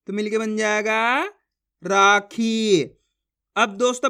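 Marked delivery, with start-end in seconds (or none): 1.8-2.8 राखी very slow, both vowels held; syllables drawn out. Shown as a demonstration. Teacher joins the syllables, राखी drawn out. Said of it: "जिस तरीके से मैं खींच खींच के पढ़ रहा हूँ".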